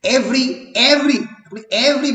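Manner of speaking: every with E hit hard and ry light; teacher tapping out the stressed syllable of every; stress on first syllable. Shown as a demonstration. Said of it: "ইতে stress পড়ছে".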